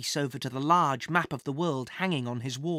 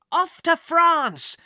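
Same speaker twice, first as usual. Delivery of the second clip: high, loud, drawn-out send-off; triumphant departing shout; excited cry; 10 semitones higher, 8 dB louder, much slower than usual. A narrator's reading. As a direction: cry out as you launch the adventure. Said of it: "Jack cried".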